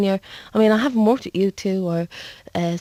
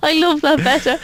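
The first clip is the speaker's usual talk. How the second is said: giggly and higher, words through laughter; laughing; 7 semitones higher than usual. Real, not acted. Singer cracking up at the host's rude rhyme, giggling. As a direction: laugh it out, tickled. Giggling after silly poem.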